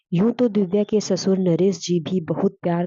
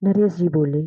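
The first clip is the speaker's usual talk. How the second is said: usual speaking voice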